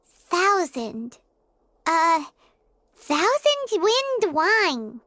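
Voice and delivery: slow, word-by-word reading of a label; halting read-out. Acted. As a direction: sound out the note one word at a time. Slowly reading a faded note.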